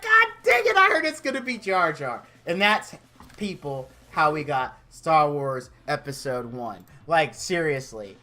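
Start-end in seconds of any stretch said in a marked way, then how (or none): 0.0-2.2 high-pitched, worked-up outburst; comic strained excitement; Harrison Ford. Acted. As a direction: burst in with a high, hammed-up exclamation. Harrison Ford bursts into the celebration.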